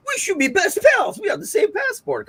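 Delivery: high-pitched, loud gushing imitation; mock overeager. Mimicking people who take shared nationality to the extreme, mock overeager. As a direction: squeal it like an over-the-top new best friend.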